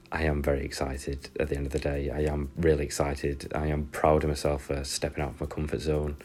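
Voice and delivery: ordinary talk, nothing marked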